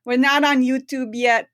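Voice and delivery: put-on Italian accent, loud and bright; playful Italian accent. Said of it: "I appreciate the Italian accent".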